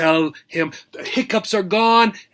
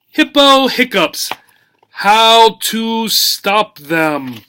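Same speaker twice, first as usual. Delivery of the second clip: reading a title; 6 semitones higher, 5 dB louder, slower than usual. Shown as a demonstration. Reads book title slowly.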